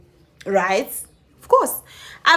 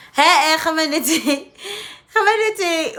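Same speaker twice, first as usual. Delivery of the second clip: slow, higher hey hey to hook the listener; drawn-out call; 6 semitones higher, slower than usual. Real, not acted. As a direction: stretch it out for drama. Building up to the twist.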